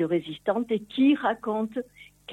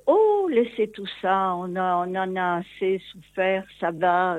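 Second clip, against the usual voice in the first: closing up; 4 dB louder than usual. Retold or imitated. Daughter imitating her mother refusing questions about the war, closed-off brush-off. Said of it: "elle se ferme et elle dit".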